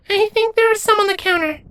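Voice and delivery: put-on very high, small childlike creature voice; small changeling nymph. A narrator's reading. Nymph answering Lenz in a high little voice.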